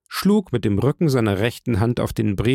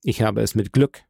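ordinary delivery

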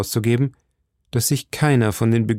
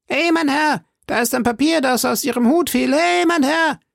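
put-on very high, boyish calling voice; stable boy calling. A narrator's reading. Stable boy tells the rider of a dropped paper, calling high. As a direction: keep calling after him, high-pitched. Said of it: "rief ein Stalljunge".

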